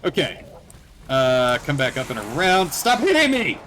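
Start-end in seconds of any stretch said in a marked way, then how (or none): 2.7-3.6 high yell at the zombies; frustrated yell. Real, not acted. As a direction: shout it out, fed up. Zombie hitting him.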